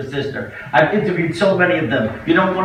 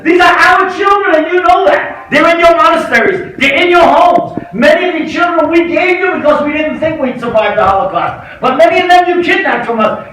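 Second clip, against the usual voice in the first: pleading shout; 12 semitones higher, 10 dB louder than usual. Retold or imitated. Rabbi shouting at the Pope to return the children, pleading. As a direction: roar the demand at the Pope, begging. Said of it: "I pleaded with him".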